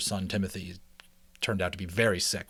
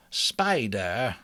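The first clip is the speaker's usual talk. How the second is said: lightly voiced wise old rat; a hint of a grave old doctor giving his verdict; old Mister Ages; 3 semitones higher, 4 dB louder than usual. Mister Ages naming the bite over Timothy, lightly voiced.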